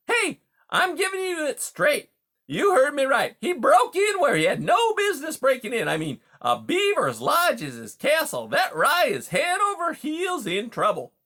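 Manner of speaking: high, loud, pushy patter; brash, indignant beaver. Acted. Beaver complains of a break-in at his lodge, brash and indignant. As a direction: lay down the law, loud and high.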